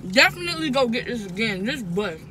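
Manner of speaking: talking while chewing; mouth full. Real, not acted. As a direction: between bites of burger. Seen 1: Repeatedly bites the burger and talks with cheeks full throughout.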